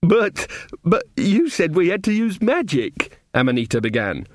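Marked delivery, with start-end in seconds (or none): 0.0-3.2 high, slow, stammering objection; protesting girl. Acted.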